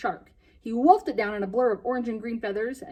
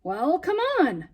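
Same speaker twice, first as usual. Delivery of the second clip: coaxing; 7 semitones higher, 5 dB louder, slower than usual. Acted. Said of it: "coaxed Benji Driver".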